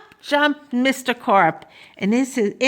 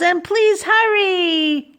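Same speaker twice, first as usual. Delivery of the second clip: high, drawn-out plea; boy's urgent yell; 9 semitones higher, slower than usual. A narrator's reading. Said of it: "I yelled".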